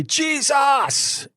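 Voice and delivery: high ringing call of the name, stretched out; triumphant cry. Retold or imitated. Man breaks free of the demon with a ringing cry. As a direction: let the name burst out at last. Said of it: "my mouth opens and I say".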